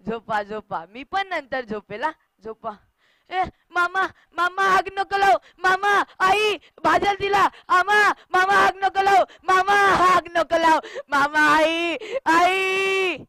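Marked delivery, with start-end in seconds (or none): 3.3-13.2 high, loud wailing plea; anguished cries for mother; panicked child screaming. Acted. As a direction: scream to stop them, building to a long cry. Child begs uncle not to burn mother.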